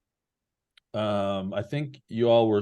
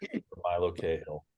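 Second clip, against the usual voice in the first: low, soft and dejected; sad bard; 4 semitones lower, 6 dB quieter than usual. Acted. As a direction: finish glumly, under the breath. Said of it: "he looks kinda sad".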